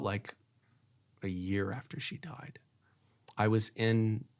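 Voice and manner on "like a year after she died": whispered, much softer; hushed whisper. Real, not acted. Remembering her late mom, whispered. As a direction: let it drop to a bare whisper. Recalling mother's death.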